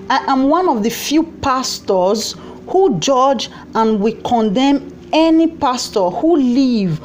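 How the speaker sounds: slow, deliberate read-out of a statement; reading aloud. Real, not acted. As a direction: read it out slowly, phrase by phrase.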